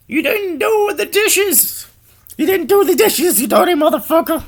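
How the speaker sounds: very high, loud nagging screech; nagging wife yell. Acted. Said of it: "in a nagging voice".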